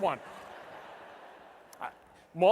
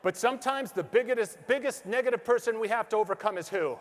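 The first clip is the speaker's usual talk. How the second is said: normal talking voice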